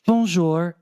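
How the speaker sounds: put-on high, eager French greeting with an American accent; awful American accent. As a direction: imitate your own hopeful tourist French, American-accented. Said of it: "my awful American accent".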